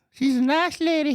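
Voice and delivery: high, put-on sugary repeat of She's a nice lady; mock sweet voice. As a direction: coo it in a fake-sweet voice.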